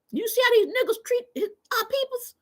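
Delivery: high, squeaky mocking of herself; mock your own outburst; high mock outrage. Streamer mocking her own outburst in line. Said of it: "I said".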